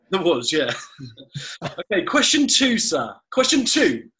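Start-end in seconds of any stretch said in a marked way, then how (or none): 1.6-4.1 high, loud game-show announce; quiz host announcing. Acted. Host calling out the next question, loud game-show voice.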